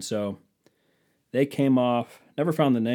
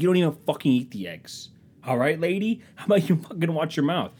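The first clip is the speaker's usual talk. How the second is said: higher-pitched comic annoyance; mock indignant scolding; 6 semitones higher than usual. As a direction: snap back in a hammed-up quarrel. Acted quarrel over the eggs.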